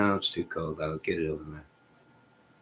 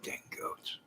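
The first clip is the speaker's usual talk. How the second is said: whispered aside of annoyance; muttered grumble; 16 dB quieter than usual. Real, not acted. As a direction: grumble it under your breath. Grumbling at goats.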